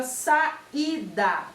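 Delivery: "saída" slowly broken into sa, i, da; syllable by syllable. Shown as a demonstration. Teacher presenting an example word to pupils, "saída" syllable by syllable. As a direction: space out each syllable of "saída". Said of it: "separar as sílabas da palavra saída".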